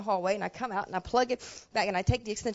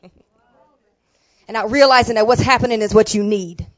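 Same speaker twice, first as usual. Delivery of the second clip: voice of God; 10 dB louder than usual. Retold or imitated. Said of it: "He said".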